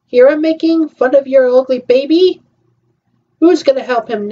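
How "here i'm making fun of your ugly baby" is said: normal talking voice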